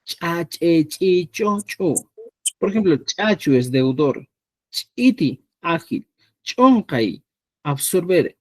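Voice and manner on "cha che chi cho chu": "Cha, che, chi, cho, chu" each with a popped glottal ch'; glottalized ch. Shown as a demonstration. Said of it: "viene a producirse como".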